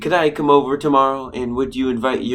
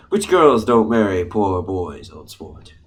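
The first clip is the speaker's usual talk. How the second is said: put-on drawn-out posh "old sport"; affected old-money accent; 6 semitones lower, slower than usual. Gatsby pining for Daisy, affected old-money accent. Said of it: "this weird accent I use".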